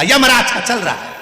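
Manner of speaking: irritated retort. Retold or imitated. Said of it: "अब इसको आ गई गुस्सा".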